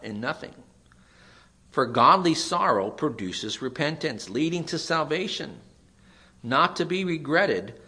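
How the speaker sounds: high, loud preaching build. Acted. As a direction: drive the verse home from the pulpit. Preaching the verse with rising force.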